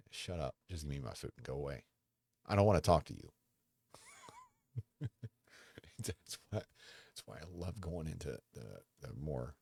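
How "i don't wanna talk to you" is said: grumpy outburst. Retold or imitated. Grumpy retort to chatty staff.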